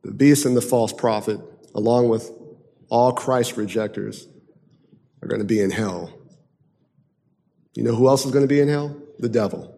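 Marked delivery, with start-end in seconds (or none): none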